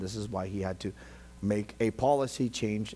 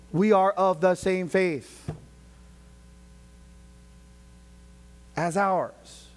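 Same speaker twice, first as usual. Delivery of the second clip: raised, higher preaching voice; emphatic preaching; 6 semitones higher, 6 dB louder, slower than usual. Real, not acted. Preacher rejecting labelled churches from the Bible, raised emphatic preaching. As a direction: declare it firmly to the congregation.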